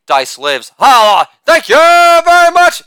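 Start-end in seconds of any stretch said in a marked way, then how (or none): 0.8-1.3 loud, high shouted hype word; catchphrase shout. Acted. Catchphrase shout after joke. 1.5-2.8 much higher, slow, loud showman's thank-you; put-on catchphrase voice. Repeated catchphrase after joke.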